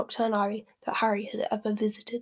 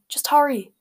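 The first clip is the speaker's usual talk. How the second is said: louder, higher, impatient urging; 5 semitones higher, 7 dB louder than usual. A narrator's reading. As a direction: hurry them along impatiently. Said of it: "said Harry impatiently".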